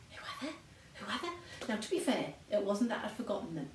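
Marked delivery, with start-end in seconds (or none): 0.1-1.7 hushed, high mock confusion; soft, higher-pitched baffled muttering; puzzled aside. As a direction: act out wondering under your breath. Baffled aside, retold under her breath.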